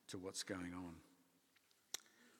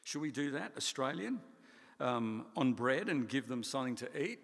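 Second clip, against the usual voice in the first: louder, mocking incredulity; sarcastic; 2 semitones higher, 6 dB louder than usual. Disciples sarcastically question Jesus's plan, loud sarcastic. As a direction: scoff at the cost, mock the idea. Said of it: "You note the little sarcasm".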